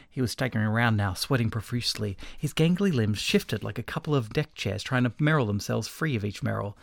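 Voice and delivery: plain reading voice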